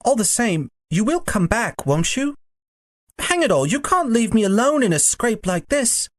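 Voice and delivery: put-on high boy's voice, protesting; high-pitched, insistent boy; pleading Diggory. A narrator's reading. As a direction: appeal urgently in a high schoolboy voice.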